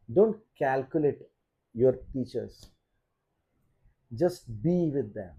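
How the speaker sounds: slow, earnest plea to students; sincere advice. Real, not acted. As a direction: say it slowly, heart to heart. (धीमी, सच्ची सलाह)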